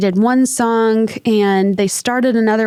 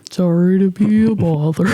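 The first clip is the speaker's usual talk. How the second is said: Eeyore voice; 2 semitones lower than usual. Pretend. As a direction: apologize like a sad stuffed donkey. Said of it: "Just walk around like that".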